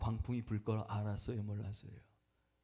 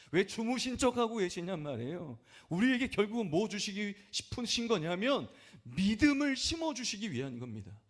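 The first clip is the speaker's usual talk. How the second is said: preaching emphasis; 9 semitones higher, 5 dB louder than usual. Acted.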